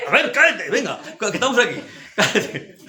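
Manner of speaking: high-pitched, laughing impatience; comic high-voiced dare; exasperated mock urging. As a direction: imitate fed-up goading with a laugh. Same retold outburst, acted again.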